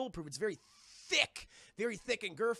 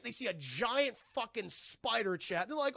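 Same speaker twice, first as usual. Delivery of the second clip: ordinary talk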